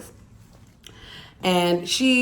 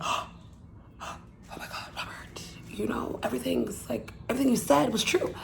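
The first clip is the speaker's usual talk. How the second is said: breathless shocked whisper, much softer; stunned whisper; 4 semitones higher, 11 dB quieter than usual. Retold or imitated. Woman realizing her ex was right, a stunned whisper. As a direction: whisper it in disbelief. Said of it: "sitting there stuck, stupid".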